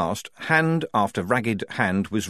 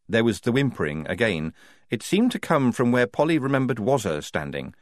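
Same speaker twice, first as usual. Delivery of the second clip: plain reading voice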